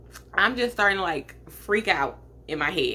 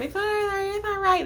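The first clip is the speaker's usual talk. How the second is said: soothing, lifted much higher; high comforting mom; 13 semitones higher than usual. Retold or imitated. Mom soothes crying daughter in car, high comforting coo. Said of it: "My grandma, my mom".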